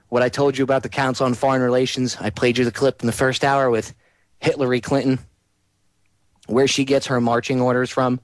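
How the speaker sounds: ordinary talk, nothing marked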